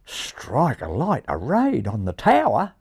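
slow, amazed Australian exclamation; astonished Australian pigeon; excited Australian. Acted. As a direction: draw it out in an Australian accent. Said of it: "seemed quite excited".

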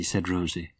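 usual speaking voice

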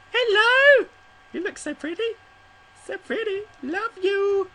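put-on high squeaky baby talk to a pet; cutesy baby talk. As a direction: coo at it like a cute animal. Greeting a cute creature.

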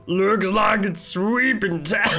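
slow put-on catchphrase, ending in laughter; imitating sweeper character. Player mimicking the sweeper's line, slow imitation. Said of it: "It honestly sounds just like Jake".

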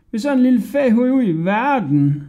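high, loud scoffing fisherman; mocking fisherman. A narrator's reading. Fisherman mocking Hodja, high scoffing voice. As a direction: scoff at the little boy. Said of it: "brølede de af grin".

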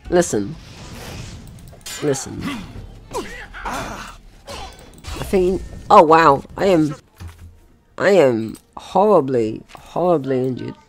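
ordinary talk

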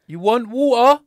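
high, loud tourist pidgin; turned up and simplified. Acted. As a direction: blare it slowly at a foreigner. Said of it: "turn it up".

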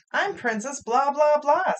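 high, lilting princess voice; cartoon princess. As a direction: announce yourself like a grand cartoon princess. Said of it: "said the princess".

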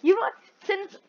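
ordinary talk, nothing marked

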